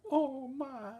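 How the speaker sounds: giggly lovestruck figure. Acted. Lovestruck object figure sighing over the onion, giggling. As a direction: swoon over your onion.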